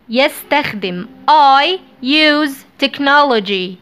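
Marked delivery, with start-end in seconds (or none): 1.3-3.8 I use technology drawn out slowly; slow, clear model sentence; slow dictation. Shown as a demonstration. (نطق بطيء)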